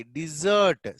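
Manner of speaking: "డిజర్ట్" as di-ZURT, second syllable held; pronunciation model. Shown as a demonstration. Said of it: "దీని pronunciation వచ్చి". ("డిజర్ట్" రెండో అక్షరం సాగదీసి పలకడం)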